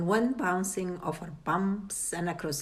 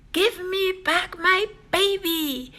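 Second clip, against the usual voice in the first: high, louder desperate yell; 12 semitones higher, 4 dB louder than usual. A narrator's reading. Father yelling down the hole for his daughter, desperate yell. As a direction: cry out for the lost child. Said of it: "Cheryl's father yelled down the hall".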